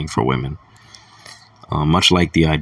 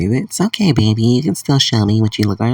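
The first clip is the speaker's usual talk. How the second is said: much higher, cooing mock woman's voice; high mock-seductive imitation of a woman; mock sweet woman; 6 semitones higher than usual. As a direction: put on a high, sugary voice to mock her. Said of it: "she's like".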